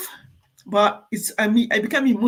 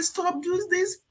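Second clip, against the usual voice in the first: mock telling off; 12 semitones higher, 5 dB quieter, slower than usual. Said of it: "and you say".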